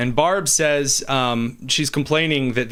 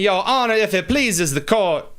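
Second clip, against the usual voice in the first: Southern accent; 7 semitones higher than usual. Shown as a demonstration. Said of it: "I could do that Southern accent".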